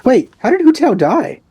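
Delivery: sudden high startled question, then still puzzled; surprised. Real, not acted. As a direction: caught off guard, voice jumping up.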